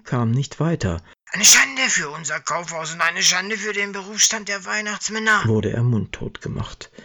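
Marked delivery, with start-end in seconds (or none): 1.3-5.4 indignant scolding. Acted. Manager silences Herbie.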